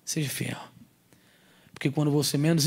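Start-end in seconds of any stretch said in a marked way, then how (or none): 0.1-0.6 soft repeat. Real, not acted. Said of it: "repeated softer".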